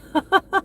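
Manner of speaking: short high laugh, loud and bright; burst of laughter. Real, not acted. Laughs after venting joke.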